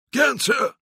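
high, keen sailor's reply; called-out assent. A narrator's reading. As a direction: call back willingly to the captain. Diego answers his captain.

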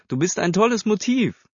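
high-pitched, lively teasing; playful teasing. A narrator's reading. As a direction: tease brightly while filming her. Laughing cousin teases while filming. (neckisch, mit hoher Stimme)

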